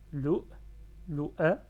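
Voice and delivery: LOU, then LOU A, short and separated; syllable by syllable. Shown as a demonstration. Teacher cuts word into syllables for learners, short and firm. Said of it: "en coupant syllabe".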